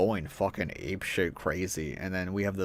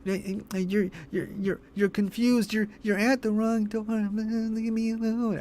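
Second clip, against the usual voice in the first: high, stuttering falsetto, trailing into a feeble plea; mock Gloria; 12 semitones higher than usual. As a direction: mimic a flustered woman stuck on her words. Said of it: "Gloria's just like".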